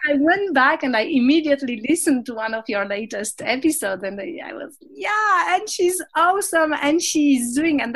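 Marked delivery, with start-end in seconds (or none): 4.9-7.8 high, loud, delighted gush; excited gush. Retold or imitated. Remembering finding the host's show, excited gush. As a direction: gush like a fan who just found her favourite show. Retells own excited reaction.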